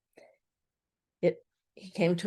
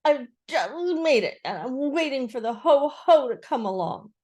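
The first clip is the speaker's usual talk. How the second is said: flustered Piglet; 6 semitones higher, 5 dB louder than usual. A narrator's reading. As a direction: bluster in a little animal voice. Piglet blustering on.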